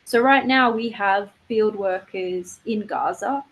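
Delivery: ordinary talk, nothing marked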